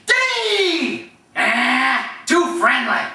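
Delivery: high coach's yell, then a put-on grunt; Wolf Cool. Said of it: "There's Wolf Cool impressions".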